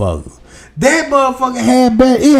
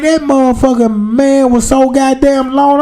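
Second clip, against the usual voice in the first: ordinary talk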